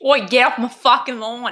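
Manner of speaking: lightly put-on Aussie homeowner voice; mock Australian accent. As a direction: imitate an Aussie telling people off. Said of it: "your attempt at an Australian accent".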